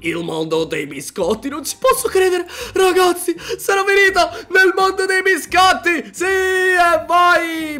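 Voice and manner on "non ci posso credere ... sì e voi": excited shouting. Acted. Player telling the viewers about the cookie world, shouting high. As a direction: burst out with the news.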